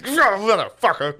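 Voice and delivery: high-pitched imitated outburst; hammed-up startled swearing; mock startled fury. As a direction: curse as if grabbed from behind. Reenacting the startled man's furious reaction.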